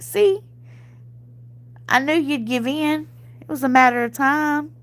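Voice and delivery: high, told-you-so gloat; high teasing told-you-so. Retold or imitated. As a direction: crow that she knew he'd cave. Teasing husband who gave in.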